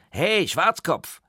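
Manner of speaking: put-on high, loud boyish holler; full-throated call. A narrator's reading. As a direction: call across the water to a rower. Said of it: "aus vollem Hals".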